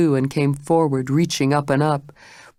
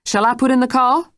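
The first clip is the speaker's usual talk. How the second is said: higher, challenging question; demanded; 9 semitones higher, 4 dB louder than usual. Acted. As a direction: dare her to say yes. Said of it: "she demanded".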